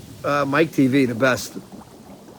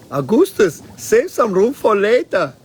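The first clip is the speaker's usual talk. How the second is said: higher, slower doting-mother voice; mother's voice; 6 semitones higher, 4 dB louder, slower than usual. Retold or imitated. Quoting the mother teasing her greedy boy, hammed-up sweetness. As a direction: coo it like a proud mom.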